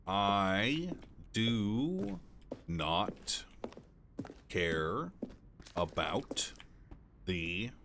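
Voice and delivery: each word alone with long gaps; soft, drawn-out words spaced far apart; halting word-by-word. Real, not acted. Streamer tuning out the game text, word by word.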